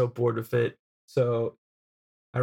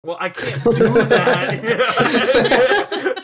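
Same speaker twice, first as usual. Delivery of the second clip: drawn out through laughter, louder and higher; laughing; 9 semitones higher, 10 dB louder, much slower than usual. Real, not acted. Reacting to co-host's crude joke, laughing. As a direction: laugh the words out slowly.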